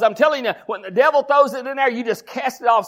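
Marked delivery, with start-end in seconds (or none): none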